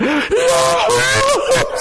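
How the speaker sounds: a sharp high shouted gasp. Retold or imitated. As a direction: gasp up high as if bursting out of the water. Baptized woman gasps from water.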